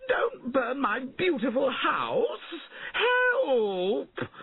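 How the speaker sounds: high, wailing scream for help; panicked crying out. A narrator's reading. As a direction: wail it out, stretching every word. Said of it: "Sir Felton cried".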